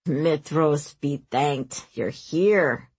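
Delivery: low, drawn-out princely welcome; relieved greeting. A narrator's reading. Ozorn greets his arriving friends, relieved, low voice. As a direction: welcome them slowly in a low voice. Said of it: "he greeted them".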